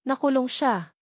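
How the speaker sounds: ordinary delivery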